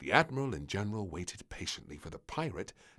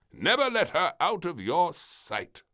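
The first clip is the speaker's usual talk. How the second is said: stern warning; 10 semitones higher, 9 dB louder than usual. Acted. Admiral warning.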